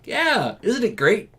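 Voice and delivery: very high, chirpy sarcasm; mock-delighted squeal; fake enthusiasm. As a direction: gush as if it were wonderful. Sarcastic reply, then flat No.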